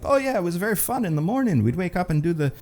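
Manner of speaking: high, hammed-up Irish accent doing Colin Farrell; a jokey, overdone Irish lilt; bad Irish accent. Vlogger imitating Colin Farrell on a talk show, in a bad Irish accent. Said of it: "I can do a bad Irish accent".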